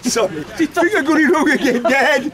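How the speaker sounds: high, laughing through the words; giggling while teasing. Real, not acted. Son teasing his dad after a joke, laughing.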